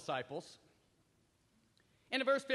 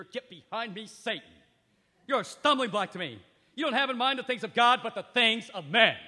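louder stern rebuke; 10 dB louder than usual. Acted. Jesus rebuking Peter, loud stern rebuke. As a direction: put him in his place. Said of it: "Now that was a rebuke".

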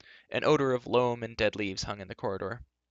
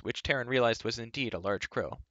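ordinary delivery

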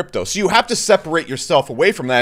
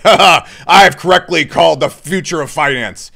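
louder, smug gloating with a laugh; smug mock investor; 4 dB louder than usual. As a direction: gloat like you're sure you won.

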